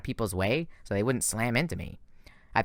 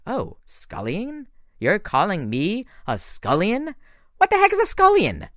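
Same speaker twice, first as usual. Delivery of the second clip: much higher, slow, ending in a loud outraged squeal; indignant retort; 10 semitones higher, 5 dB louder, slower than usual. A narrator's reading. Thalia firing back at Zoe's insult, rising to an outraged yell. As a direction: build from mocking to outraged.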